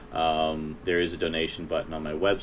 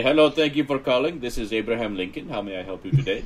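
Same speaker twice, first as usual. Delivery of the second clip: put-on Indian accent; 6 semitones higher, 4 dB louder than usual. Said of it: "I was just gonna say".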